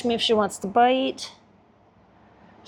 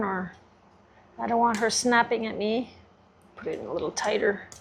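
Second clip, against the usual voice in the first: ordinary talk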